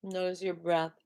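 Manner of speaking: slow, calm breathing cues; guided meditation cue. Acted. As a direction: guide their attention gently.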